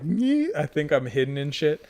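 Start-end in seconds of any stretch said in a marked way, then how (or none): 0.0-0.5 high silly nasal bleat; smug hiding noise. Retold or imitated. Player hiding in food pile, smug high noise. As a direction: make a goofy high nyeh. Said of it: "I'm just like".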